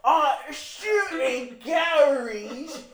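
high, slow, loud British caricature; mock British accent. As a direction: drag it out as a cartoon Brit. Mock British comeback after bashing Brits.

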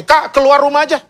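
lightly voiced youngest sibling, high; youngest eaglet. Youngest chick urging older sibling to leave home, lightly voiced youngest sibling. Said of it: "si bungsu mungkin akan ngomong ini".

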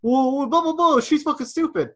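hammed-up high-pitched irate shopper; mocking angry impression. Imitating shopper turning on him in line, mock outrage.

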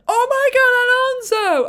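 high, loud, stretched-out fan scream; excited shout. Retold or imitated. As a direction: cry out in thrilled disbelief at the overtake. Re-enacting reaction to a mind-blowing overtake.

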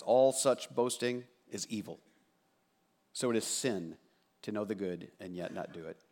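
measured Bible reading voice; scripture reading. Acted. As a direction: read the text with weight. Reads scripture aloud.

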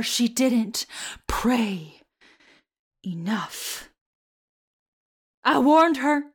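a hint of a mild southern accent, dramatic; Carrie's mom, southern accent. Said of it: "with the accent".

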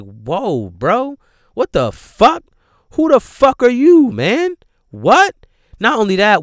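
high-pitched, loud indignant disbelief; scandalized telling-off with high, punched questions; incredulous outrage. Acted. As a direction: confront him in appalled disbelief, voice jumping up. Acted outraged reaction to movie character.

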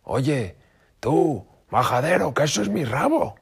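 high, loud angry outburst; angry fox. A narrator's reading. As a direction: snap at him, furious. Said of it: "El hermano zorro se enfadó".

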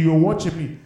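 usual speaking voice

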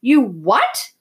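loud, incredulous outburst; shouting. A narrator's reading. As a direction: shout it back in outrage. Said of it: "He was shouting".

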